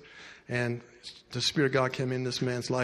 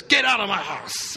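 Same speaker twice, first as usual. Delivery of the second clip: retold angry yell at the door, raised and higher; angry shout; 6 semitones higher, 6 dB louder than usual. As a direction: throw her out of the house, angrily. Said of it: "he got mad and says".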